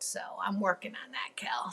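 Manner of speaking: whispered aside. Real, not acted. Replying to Kelly's joke in a whisper. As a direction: confide it in a whisper. Joking reply to friend's comment.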